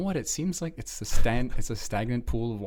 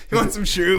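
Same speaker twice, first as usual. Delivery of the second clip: goofy dealer; 8 semitones higher, 5 dB louder than usual. Pretend. Mocking biker dealer.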